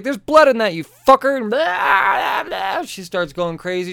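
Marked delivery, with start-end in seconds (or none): 0.0-1.4 furious outburst. Retold or imitated. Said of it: "She's like". 1.4-2.8 mock ranting. Imitating Olive's angry ranting.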